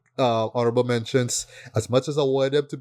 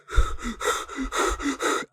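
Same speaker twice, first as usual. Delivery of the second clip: long breathy exhale, pitched up; goat breathing; 14 semitones higher than usual. Retold or imitated. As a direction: imitate the goat's heavy breath. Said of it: "dude is just breathing".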